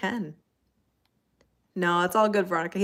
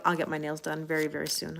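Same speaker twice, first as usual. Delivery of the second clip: ordinary talk, nothing marked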